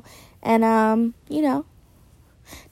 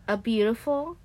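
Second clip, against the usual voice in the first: normal talking voice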